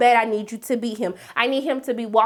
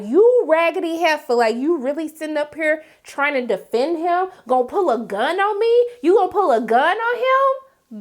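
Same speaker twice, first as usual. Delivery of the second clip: rising furious mock scolding; voicing Diamond's anger; 5 semitones higher than usual. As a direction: voice the boyfriend's outraged disbelief. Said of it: "the look that Diamond gave her".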